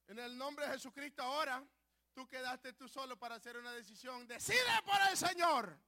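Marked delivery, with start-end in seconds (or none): 4.3-5.8 loud, slow shouted appeal; fiery preaching. Acted. Preacher calling the congregation to decide, shouting. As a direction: drive the call home.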